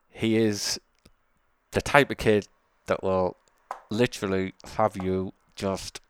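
words pushed out through clenched teeth; through gritted teeth. Real, not acted. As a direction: speak with your jaw locked tight. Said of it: "talking through gritted teeth like I am right now".

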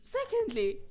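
high, loud, indignant big sister; exasperated outrage. Retold or imitated. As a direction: scold him in disbelief. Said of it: "I was like".

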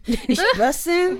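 slow, high put-on voice for it's bussin'; TikTok slang voice. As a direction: ham up the TikTok catchphrase. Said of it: "all I hear is bussin'".

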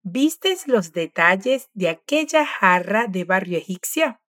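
slow, careful question with rising end; slow model question. Shown as a demonstration. Teacher modelling a full question slowly. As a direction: read the question slowly for the learner. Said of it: "Ahora vamos a repetir la pregunta completa".

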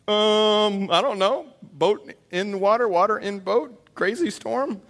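high, slow, dopey voice; dim-witted fisherman caught out; clueless disciple. Acted. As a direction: play the baffled, sheepish disciple. Clueless disciples answer Jesus.

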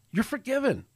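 high-pitched, heartfelt proclamation; proclaiming forgiveness. Acted. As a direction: lift the voice high, as if pronouncing a pardon. Preacher proclaims forgiveness to congregation.

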